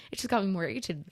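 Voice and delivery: normal talking voice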